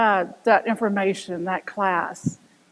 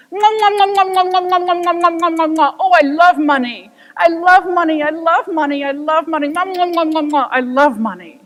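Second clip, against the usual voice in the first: higher, louder gobbling "nom nom" voice; greedy money lover; 5 semitones higher, 8 dB louder than usual. Retold or imitated. Businessman gloating over money onstage, gobbling voice. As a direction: savor it like a greedy glutton.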